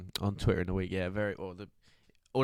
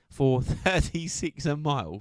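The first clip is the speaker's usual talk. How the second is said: higher, slow, amazed repeat; incredulous emphasis; 6 semitones higher, 4 dB louder, slower than usual. Real, not acted. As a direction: stretch it out in disbelief. Amazed repeat of the pace, "ridiculous.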